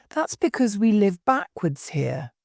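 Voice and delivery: ordinary delivery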